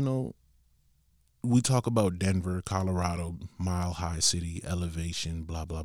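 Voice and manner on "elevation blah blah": ordinary talk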